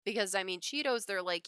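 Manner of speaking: ordinary talk, nothing marked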